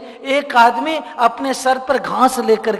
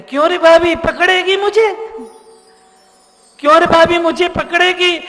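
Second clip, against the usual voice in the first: higher, louder taunting; cheeky tease; 7 semitones higher, 8 dB louder than usual. Acted. Krishna teasing sister-in-law, cheeky. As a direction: taunt her playfully.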